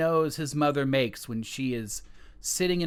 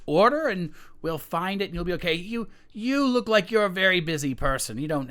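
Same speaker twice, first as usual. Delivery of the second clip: nervous son; 7 semitones higher, 4 dB louder, faster than usual. Acted. Son reassures the king.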